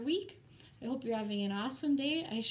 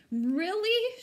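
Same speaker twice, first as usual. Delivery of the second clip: tearful surprise; 9 semitones higher, 6 dB louder than usual. Acted. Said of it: "she asked through her tears".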